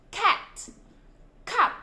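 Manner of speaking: "cat, cup" with a hard puff of air on each c; strongly aspirated k. Shown as a demonstration. Teacher gives pupils examples, "cat, cup" strongly aspirated. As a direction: burst the breath out on the first sound of "cat, cup". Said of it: "bật hơi mạnh hơn cho cô".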